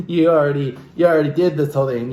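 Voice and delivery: ordinary delivery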